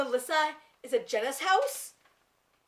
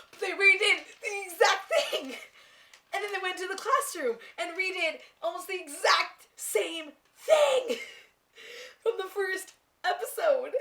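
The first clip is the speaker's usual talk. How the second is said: excited rant; 7 semitones higher than usual. Real, not acted. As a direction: keep ranting in excitement. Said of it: "here comes the rant".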